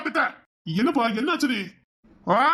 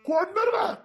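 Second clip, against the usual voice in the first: crying threat; 6 semitones higher, slower than usual. Acted. Threat shouted through crying.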